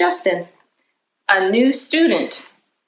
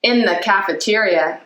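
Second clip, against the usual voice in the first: plain reading voice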